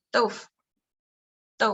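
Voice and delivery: 豆腐 with proper tones and a reduced ending; correct Mandarin. Shown as a demonstration. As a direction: model the right 豆腐 for the student. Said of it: "豆腐 is wrong".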